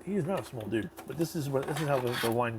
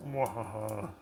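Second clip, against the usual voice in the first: evil laugh; slower than usual. Acted. After villain quote.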